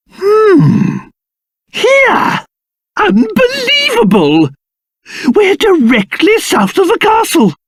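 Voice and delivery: high-pitched, excited exclamations; astonished discovery. Acted. King finding his castle close by on the map, amazed. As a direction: pitch leaping up in disbelief.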